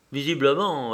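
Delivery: usual speaking voice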